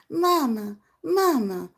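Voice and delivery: a hint of a little girl calling; lightly voiced child. Daughter at her mother's knees, light child's plea. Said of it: "your daughter's at your knees going".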